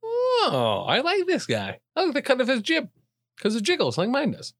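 put-on high, squeaky kid voice; childlike voice. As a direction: play a giddy little kid. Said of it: "I was like".

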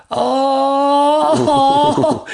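long, loud, high "oh" of mortification; drawn-out mock wail with a laugh; embarrassed wail. Caught embarrassed by his student.

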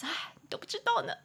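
high, breathy mock-tragic whisper; a joking, high whisper to a friend. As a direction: whisper the secret suffering as a joke. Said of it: "开玩笑嘛".

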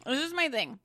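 speaking through a mouthful; words around a bite of food; chewing while talking. Real, not acted. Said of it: "Sorry, I'm eating".

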